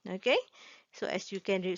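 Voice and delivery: ordinary delivery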